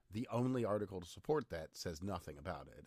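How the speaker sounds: ordinary delivery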